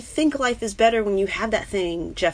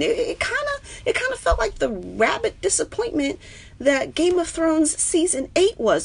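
high, half-crying complaint; a mock sob over a disappointing finale; up in my feelings; 10 semitones higher than usual. Real, not acted. Streamer lamenting Game of Thrones, half-crying. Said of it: "getting all up in my feelings".

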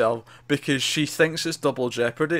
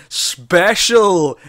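louder, drawn-out offended retort; offended correction; 2 semitones higher, 6 dB louder, much slower than usual. Retold or imitated. Agent offended at being called an FBI agent, mock-indignant and emphatic. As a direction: stretch each word out with mock indignation. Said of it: "she gets offended".

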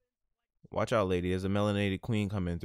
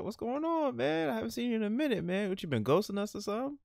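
much higher, squeaky friend voice; high-pitched buddy; 14 semitones higher than usual. Pretend. Gym friend teases D-Lo in a high squeaky voice.

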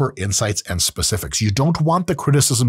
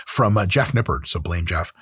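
normal talking voice

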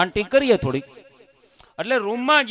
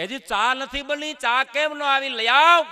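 higher, slow call toward the kitchen; mock yell to the wife; 9 semitones higher, slower than usual. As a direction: shout for the tea from the next room. Husband calling out to kitchen.

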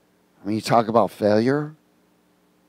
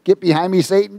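high, raised mock rebuke of Satan; 7 semitones higher, faster than usual. Preacher voicing Jesus, a playful high rebuke. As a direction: play Jesus scolding his friend, half joking.

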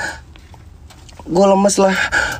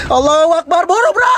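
panicked cry; 7 semitones higher than usual. Real, not acted. Panicked trapped in car.

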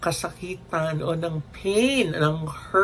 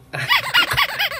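high burst of laughter. Real, not acted. Vlogger laughing at her own silly word.

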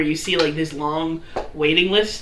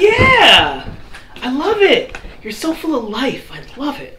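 elf woman voice; 7 semitones higher than usual. Acted. Elven woman praising a dancer, high and gushing. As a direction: gush with delight, then trail off. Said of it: "She's like".

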